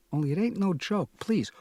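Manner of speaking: plain reading voice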